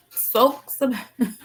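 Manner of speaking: slow "Sok Sabaye" with "Sok" stressed; careful repeat. Shown as a demonstration. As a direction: sound out "Sok Sabaye" slowly, learning it. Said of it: "Wait, what?".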